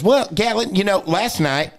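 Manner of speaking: high-pitched grandma explaining; grandma's voice. Retold or imitated. Grandma explaining to grandson, high imitation. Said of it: "That's how she talked".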